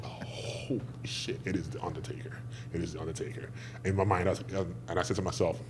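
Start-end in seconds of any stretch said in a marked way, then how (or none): 0.1-3.5 hushed, stunned whisper; awed whisper. Retold or imitated. Wrestler recalling first sight of The Undertaker, awed whisper.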